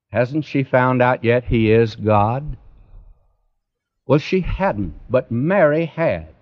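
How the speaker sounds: usual speaking voice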